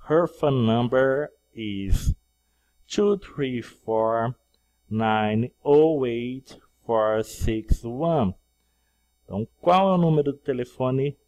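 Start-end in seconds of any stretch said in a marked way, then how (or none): 0.1-8.3 slow, carefully spaced dictation; slow, clear number dictation. Shown as a demonstration. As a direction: read each digit slowly for learners. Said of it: "teacher dictates slowly".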